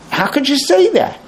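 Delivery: high, put-on offended voice; hurt wife. What men hear from the wife, high mock hurt. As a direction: play her shock, pitched well up. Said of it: "what they hear is".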